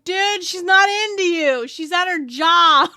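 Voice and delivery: slow, dude-bro put-down; bystander guy. Retold or imitated. Bystander tells a flirting coach to back off, slow and bro-ish. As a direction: slowly tell the guy off, bro to bro. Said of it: "some guy's like".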